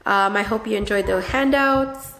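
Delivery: ordinary talk, nothing marked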